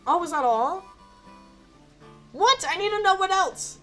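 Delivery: high, loud disbelieving outburst; real indignant surprise. Real, not acted. Player finds the story already over, indignant surprise.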